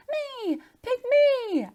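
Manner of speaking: put-on very high, loud kid's plea; eager shout. Girl wants to take the mice home, eager shout. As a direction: beg to be picked. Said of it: "I shouted".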